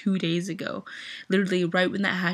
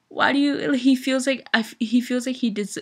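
higher and louder, rushing in disbelief; exasperated outburst; 5 semitones higher, 5 dB louder than usual. Real, not acted. Fan protesting [name]'s self-doubt, high exasperated outburst. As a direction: burst out as if pleading with him.

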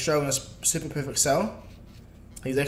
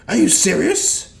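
loud, slow, higher outburst; incredulous; 5 semitones higher, 9 dB louder, slower than usual. Retold or imitated. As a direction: drag it out in disbelief. Goku shocked by news.

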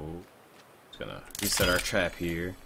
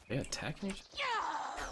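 squeaky surprise; 8 dB quieter than usual. Real, not acted. Surprised by monsters fighting.

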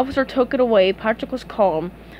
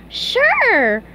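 high-pitched, gleeful yes; eager agreement; 12 semitones higher, 4 dB louder than usual. Acted. SpongeBob replies to Patrick's offer, eager. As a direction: agree at once, voice leaping up.

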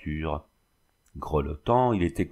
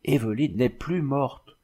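slow, higher, stammering in shock; stammered; 4 semitones higher than usual. A narrator's reading. James stammers in shock over Evelyne, slow stammering. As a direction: stumble over the words, overwhelmed. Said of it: "il balbutiait".

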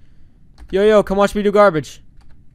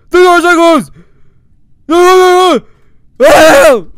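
high, loud panicked screaming; 8 semitones higher, 9 dB louder, slower than usual. Real, not acted. Gamer screams at the mic as time runs out, panicked. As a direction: shout in panic as the timer runs out. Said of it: "I screamed to the mic".

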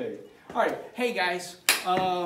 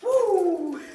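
loud high whoop; excited cheer; 13 semitones higher, 11 dB louder than usual. Real, not acted. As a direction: cheer the jump loudly. Cheering dog's jump.

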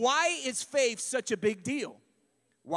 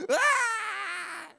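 mock wail; 9 semitones higher than usual. Imitating a wailing mourner.

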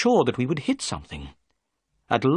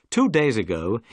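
ordinary delivery

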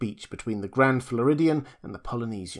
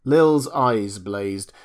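ordinary delivery

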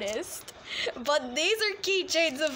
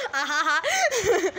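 slow fake laugh; 2 semitones higher, 5 dB louder, slower than usual.

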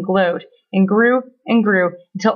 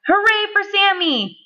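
high, celebratory cry; cheering; 10 semitones higher than usual. A narrator's reading. Crowd celebrating Sammy's victory, cheering high. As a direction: cheer the hero in storybook triumph. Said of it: "everyone cried".

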